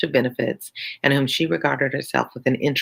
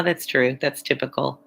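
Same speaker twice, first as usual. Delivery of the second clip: plain reading voice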